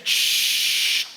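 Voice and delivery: voiceless shh; hushing. Retold or imitated. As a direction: hush the clerk fast. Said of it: "Je fais".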